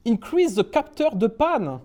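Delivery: exaggerated English accent on French. Shown as a demonstration. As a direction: say the French words as if they were English. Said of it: "j'essaye de prendre l'accent anglais".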